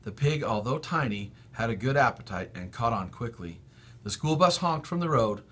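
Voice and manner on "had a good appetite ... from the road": ordinary delivery, nothing marked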